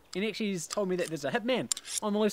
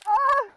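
loud high scream of fright; startled yelp; startled shriek; 8 dB louder than usual. Acted. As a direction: shriek in sudden shock. Startled by intruder.

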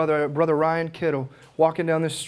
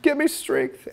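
retold crying prayer; 8 semitones higher than usual. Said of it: "crying my eyes out".

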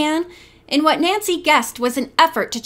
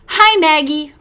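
higher, louder cheerful hello; bright greeting; 4 semitones higher, 6 dB louder than usual. Acted. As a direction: call out brightly. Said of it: "Bess said brightly".

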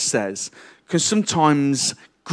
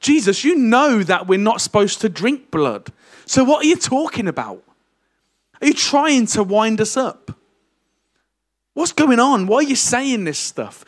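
raised indignant protest, high and louder; exasperated crowd; 8 semitones higher, 3 dB louder than usual. Retold or imitated. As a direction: voice baffled listeners demanding answers.

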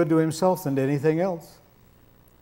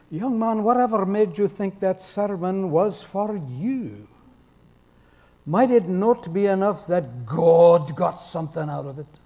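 Eric Alexander; 3 semitones higher than usual. Retold or imitated. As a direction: imitate a Scots preacher gently taking a young man to task. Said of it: "the way Eric tells the story".

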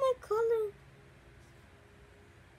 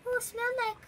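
normal talking voice

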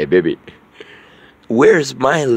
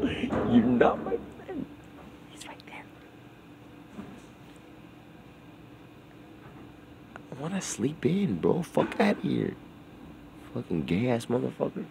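whispered and much softer so the person nearby won't hear; hushed whisper; 15 dB quieter than usual. Real, not acted. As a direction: keep it down, someone's right there. Someone right there, keeping quiet.

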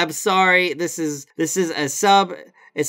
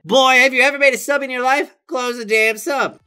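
fast, high condescending voice; mock rude customer; 9 semitones higher, 4 dB louder than usual. Imitating the customer scolding the worker, high and fast.

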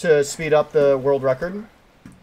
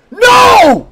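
high, very loud yell; piercing gamer scream; scream of dismay; 17 dB louder than usual. Real, not acted. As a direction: scream it out in despair. Screams at losing in game.

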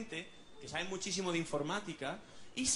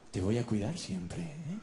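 tender comfort; 2 semitones lower, 3 dB quieter than usual. Acted. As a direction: promise to look after the panda. Comforting the panda. (consuelo tierno y en voz baja)

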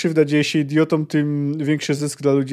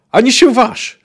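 loud, high threatening cry; angry warning; 8 semitones higher, 7 dB louder than usual. Acted. A sailor forbids the captain to turn, loud and angry. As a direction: bark the warning at him.

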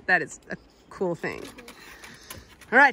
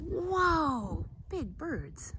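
hushed surprise; 6 semitones higher, 7 dB quieter, much slower than usual. Real, not acted. Spotting birds quietly.

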